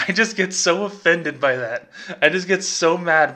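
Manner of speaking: offended, with a laugh in the voice; indignant laughing. Real, not acted. Podcaster offended by a bank's email, indignant laughing. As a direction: laugh through your indignation.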